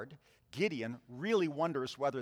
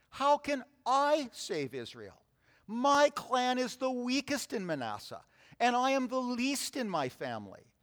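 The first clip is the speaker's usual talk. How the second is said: higher, pleading voice of a doubting man; pleading Gideon; 8 semitones higher than usual. Acted. Gideon protesting to the Lord, high and pleading. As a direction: beg the Lord, sure you are too weak. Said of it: "Gideon asked".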